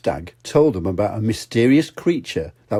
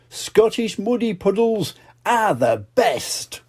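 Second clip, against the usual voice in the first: storybook ending; 10 semitones higher, slower than usual. Acted. Story's closing line.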